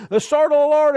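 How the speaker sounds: high, loud shouted war cry; battle cry. Retold or imitated. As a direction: yell it like an army charging. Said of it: "everyone's yelling and screaming".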